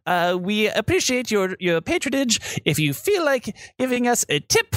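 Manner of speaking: high-pitched, half-hearted farewell speech; rushed tour guide. Acted. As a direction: recite the thanks wanting to be gone. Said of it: "wanting to get out of here".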